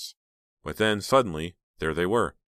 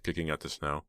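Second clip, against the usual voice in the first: plain reading voice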